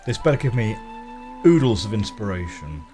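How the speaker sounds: ordinary talk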